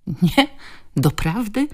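sceptical rising questions; incredulous little retorts; disbelieving snort. A narrator's reading. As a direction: scoff in disbelief, pitch lifting. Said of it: "prychnęła z niedowierzaniem".

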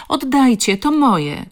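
high, loud desperate plea; tearful shout. A narrator's reading. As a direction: cry out for it back, helpless. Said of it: "krzyczała, zalewając się łzami".

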